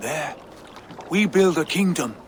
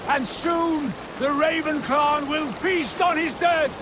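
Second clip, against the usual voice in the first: higher, ringing rally to the warriors; rousing war speech; 8 semitones higher than usual. Acted. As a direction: stir the crew for battle.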